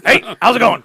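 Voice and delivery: high, loud hammed-up Beetlejuice. Friend showing off his Beetlejuice greeting. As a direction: spin round and greet like Beetlejuice. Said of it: "my Beetlejuice".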